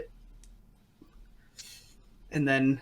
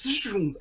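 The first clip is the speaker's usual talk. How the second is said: high zooming sound effect; imitated whoosh; 7 semitones higher than usual. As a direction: whoosh like someone flung through the air. Imitates clown flying across stage.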